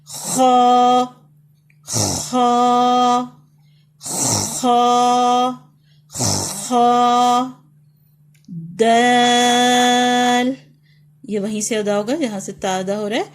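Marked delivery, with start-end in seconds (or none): 0.0-7.5 خا loud and heavy, lips unrounded, mouth opened upward; loud letter drill. Shown as a demonstration. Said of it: "آپ نے بلندی کی طرف منہ کو کھولنا ہے". 8.8-10.5 دال very slow and loud, the long aa stretched; drawn-out letter. Shown as a demonstration. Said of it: "اس کو لمبا کر کے پڑھیں گے".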